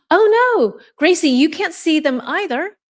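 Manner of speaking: real alarm at the glitch; dismayed surprise. Real, not acted. Teacher learns Gracie also can't see comments, dismayed.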